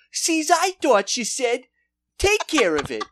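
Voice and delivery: spring the trap with mock innocence; sly Commish. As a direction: commish calling his officer in on the briber. Commish sends her off.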